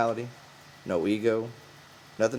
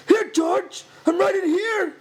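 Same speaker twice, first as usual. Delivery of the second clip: much higher, louder excited answer; eager call; 8 dB louder, slower than usual. A narrator's reading. Lenny eagerly calling back to George. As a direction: yell back like a happy child. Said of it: "he answered".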